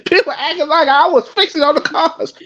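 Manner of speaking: high-pitched quote with a laugh breaking in; Exhibit. Retold or imitated. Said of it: "He was like".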